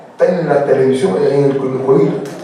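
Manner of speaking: usual speaking voice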